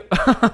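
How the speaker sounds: short high laugh; incredulous giggle; real laugh. Real, not acted. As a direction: burst out laughing in disbelief. Laughs at bigoted message.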